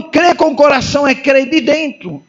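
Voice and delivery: ordinary talk, nothing marked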